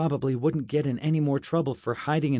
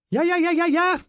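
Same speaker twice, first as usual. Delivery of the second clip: high, loud excited yapping; a much higher, louder voice for the excitable Justin; eager yapping; 8 dB louder than usual. Acted. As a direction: blurt it out like an overjoyed puppy. Excited dog-like friend babbling.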